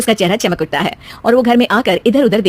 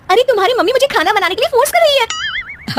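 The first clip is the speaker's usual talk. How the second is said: much higher, whiny protest; complaining wife. Acted. Wife complaining to her husband about his mother, whiny.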